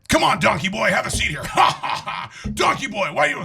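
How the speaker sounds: 1 obnoxious guy. Acted. The joke's obnoxious guy taunts Donkey Boy with a mock laugh. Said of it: "I like the act out".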